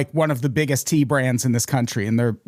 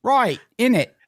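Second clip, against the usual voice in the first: pitched-up fake London accent; mock British accent; 8 semitones higher than usual. Host still playing British with co-host, mock British accent. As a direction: toss off a Cockney slang tag. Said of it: "me accent".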